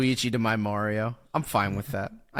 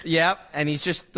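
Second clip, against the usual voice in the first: normal talking voice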